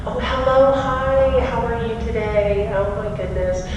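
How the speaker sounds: high, sugary phone greeting; imitating mom's sweet high phone voice. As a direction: gush like a sweet mother answering a call. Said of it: "My mom is such a sweet woman".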